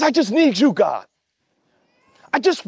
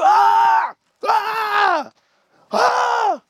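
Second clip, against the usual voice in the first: repeated shouts; 10 semitones higher, much slower than usual. Shown as a demonstration. Preacher acting out screaming the stress away. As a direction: throw out one scream after another. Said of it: "you might be doing it at you".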